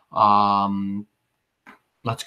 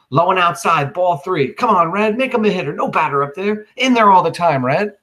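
put-on excited boy calling his own ballgame, rising higher; a kid hamming up a World Series broadcast; live play-by-play; 4 semitones higher than usual. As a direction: call the imaginary game like a radio announcer. Boy plays announcer and pitcher.

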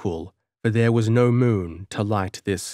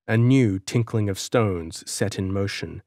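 ordinary delivery, nothing marked

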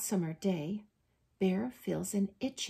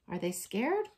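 plain reading voice

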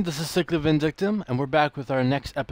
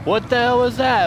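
much higher and louder, real shock; stunned outburst; 12 semitones higher, 5 dB louder than usual. Real, not acted. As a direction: blurt it out in disbelief. Ghost surprise in game.

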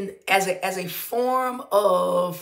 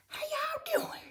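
breathy playful whisper to the viewers; hushed, teasing how y'all doing; whispered greeting; 9 dB quieter than usual. Real, not acted. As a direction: lean in and whisper hello.

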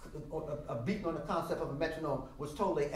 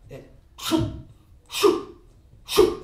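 breathy shoot getting louder each time; spurting sound; 12 semitones higher, 11 dB louder, much slower than usual. Shown as a demonstration. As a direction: make the blood spurt. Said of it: "It spurts".

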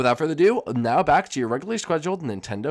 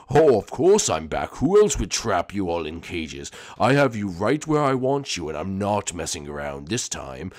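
much lower, slow, menacing villain drawl; low sinister villain; 6 semitones lower than usual. Acted. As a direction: purr it out like a creepy mastermind. Villain threatening.

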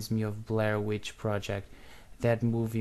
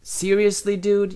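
disbelief; 9 semitones higher, 10 dB louder than usual. Real, not acted. Friend doubts what he saw.

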